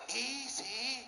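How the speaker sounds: hushed coaxing easy; gentle hushed word; soft easy. Acted. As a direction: say it softly to your man. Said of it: "just say this".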